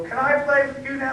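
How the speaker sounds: put-on high-pitched eager kid request; imitated little kid. Little brother asking his sister and her friend to play, imitated high kid voice.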